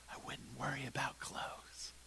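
hushed, soft close to the line; whisper. Acted. Preacher as Jesus, whispering the advice. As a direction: drop to a whisper for the punch.

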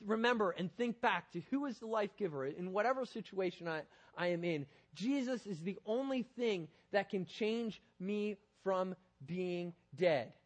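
ordinary talk

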